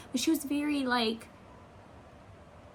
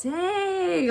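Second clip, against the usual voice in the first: high, loud, drawn-out dang; a sudden high, loud dang; impressed dang; 8 semitones higher, 4 dB louder than usual. Retold or imitated. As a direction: react like you're blown away. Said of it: "I was like".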